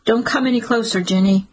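usual speaking voice

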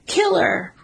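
high, taunting cry of killer; mock jeering call. A narrator's reading. Trespasser taunting across the foggy lawn, high jeering call. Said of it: "Then he calls out".

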